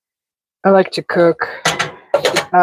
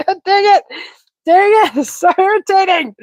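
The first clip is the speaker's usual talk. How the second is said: much higher, mock exasperated voice; mock annoyed self; 12 semitones higher, 3 dB louder than usual. Streamer retelling his annoyance at a sick friend, mock exasperated. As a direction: ham up his own irritation. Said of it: "I was like".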